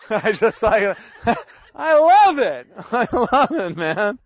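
talking through real laughter; tickled, laughing as the words come out. Real, not acted. Preacher delighted by a cartoon someone sent him, laughing.

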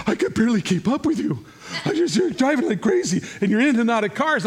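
high, loud mock outrage; imitated frantic complaint. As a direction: act out a rattled follower complaining. Retold complaint about his driving.

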